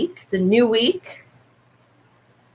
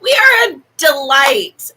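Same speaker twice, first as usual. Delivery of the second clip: loud, higher, laughing exclamation; laughing shout; 7 semitones higher, 6 dB louder than usual. Real, not acted. Streamer gushing about her friend, shouting with laughter. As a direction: burst it out through laughter.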